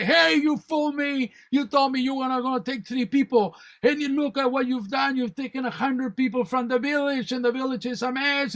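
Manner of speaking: high-pitched angry tirade; yelling. Retold or imitated. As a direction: act out a guru furiously telling off Death. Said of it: "start yelling at the, you know, at death".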